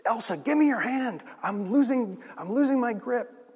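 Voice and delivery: high, loud, strained plea; Indy's urgent yelling. Acted. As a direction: call out desperately as if losing your grip. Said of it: "Indy's yelling at her".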